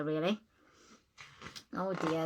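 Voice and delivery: ordinary delivery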